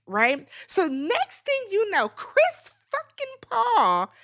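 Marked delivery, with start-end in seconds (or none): 2.1-4.1 Chris fucking Paul drawn out slow and much higher; stunned disbelief. Real, not acted. Shocked viewer spotting Chris Paul at the reunion, slow stunned disbelief. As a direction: stretch it out in pure disbelief.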